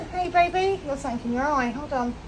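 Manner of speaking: high, sing-song pet voice. Real, not acted. Talking to her horse.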